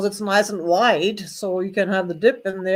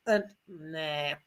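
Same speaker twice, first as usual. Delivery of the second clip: dropping quiet, let down; deflated; 6 semitones lower, 9 dB quieter than usual. Pretend. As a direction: sag into disappointment. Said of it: "I'd be all excited".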